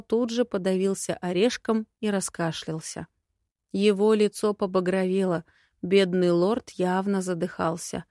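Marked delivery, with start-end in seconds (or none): none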